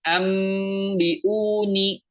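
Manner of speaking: "ambiuni" slowly with a fully closed m; chanted "ambiuni", m clearly sounded; m too clear. Shown as a demonstration. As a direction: press the lips on the m of "ambiuni". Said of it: "Jadi bukan ambiuni".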